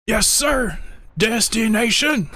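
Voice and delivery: very high, stretched soldier reply; game unit voice. Acted. Streamer imitating the siege tank, high stretched voice. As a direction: pitch it right up, like an eager tank driver. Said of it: "I forget how he says that one".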